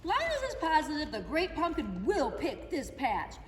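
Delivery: usual speaking voice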